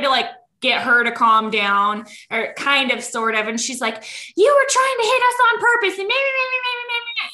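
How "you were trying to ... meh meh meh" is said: high-pitched ranting wife impression; hammed-up angry tirade, pitched way up; mock screaming. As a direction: mimic a shrieked accusation sliding into nagging babble. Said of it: "screaming at me".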